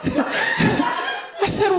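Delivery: ordinary delivery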